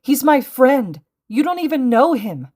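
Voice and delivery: high, loud fierce defence of a friend; seething outburst. A narrator's reading. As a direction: shout in outraged protest. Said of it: "seething inside as he glared up at the heroes".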